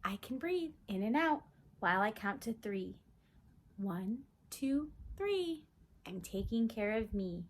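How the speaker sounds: slow, sing-song picture book read-aloud; reading to children. Acted. Teacher reading a calm-down book to kids, sing-song. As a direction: read it gently to a little one.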